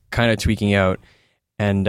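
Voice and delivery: ordinary delivery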